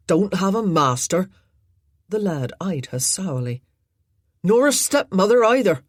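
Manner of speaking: loud, blunt defiance; defiant. Acted. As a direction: throw it back at her. Tom defies the lady.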